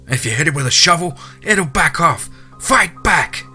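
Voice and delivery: high, urgent shouted commands; screaming. A narrator's reading. Hunter screams at fleeing man to grab a tool, urgent high shouting. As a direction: shout urgently to make him fight back. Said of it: "I started screaming right back to the man".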